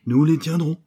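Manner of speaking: loud, high-pitched vow; resolute exclamation. Acted. Detective promises the reporter revenge, resolute exclamation. Detective vows to catch the gang.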